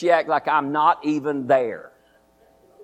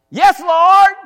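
high, loud startled reply; Abraham answering God in alarm; urgent answer; 12 semitones higher, 9 dB louder than usual. Acted. Abraham answers God's call.

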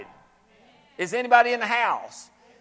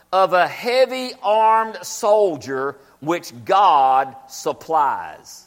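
drawn-out verse reading; slow scripture reading; 2 semitones lower, much slower than usual. Acted. Preacher reading the verse slowly to the church. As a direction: read it slowly, word by word.

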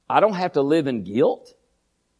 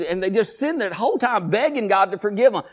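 ordinary delivery

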